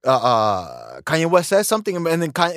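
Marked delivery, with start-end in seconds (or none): none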